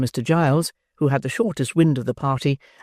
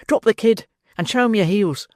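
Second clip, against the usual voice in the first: louder, higher frantic order; urgent cry; 7 semitones higher, 3 dB louder than usual. Acted. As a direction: yell at him to run. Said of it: "cried Toby".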